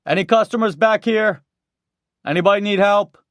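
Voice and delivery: loud, high call across store. A narrator's reading. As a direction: raise your voice to the store. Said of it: "raised his voice, calling out".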